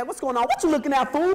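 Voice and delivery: put-on loud, high snap at the kid; angry snap. Angry kid snapping at a staring classmate, loud.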